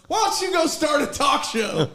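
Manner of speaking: high, loud imitated jeer; dugout heckle yell. Player mimicking rival dugout heckling Vittorio, loud yelled jeer. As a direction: shout a taunt from the opposing bench. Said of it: "Somebody from their dugout yells".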